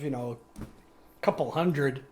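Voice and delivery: ordinary delivery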